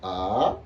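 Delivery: A rising sharply at the end; second tone. Shown as a demonstration. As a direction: Mandarin rising tone on A. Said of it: "Donc, ça fait".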